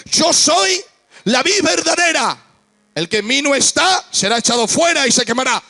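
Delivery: stern warning quoted boldly; emphatic quote. Acted. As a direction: announce it with force. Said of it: "Él dijo, por ejemplo".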